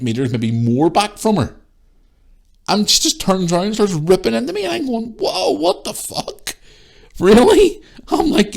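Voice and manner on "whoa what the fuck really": very high, drawn-out shock, ending loud; shocked disbelief. Retold or imitated. Shopper suddenly ripped into, shocked disbelief. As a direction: stretch it high, then punch the last word.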